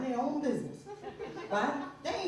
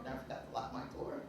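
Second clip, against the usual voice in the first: whispered, much lower; 12 semitones lower, 14 dB quieter, faster than usual. Retold or imitated. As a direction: whisper the confession. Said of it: "She said".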